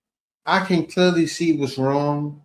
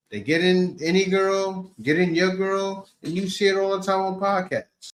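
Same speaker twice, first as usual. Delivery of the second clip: ordinary delivery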